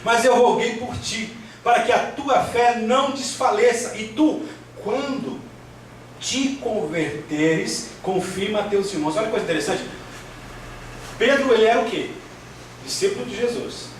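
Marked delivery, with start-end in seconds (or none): 0.0-9.2 raised, measured preacher's reading; scripture reading aloud. Acted. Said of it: "vou ler rapidinho aqui". 11.2-13.9 high-pitched, driving sermon delivery; preaching at full force. Real, not acted.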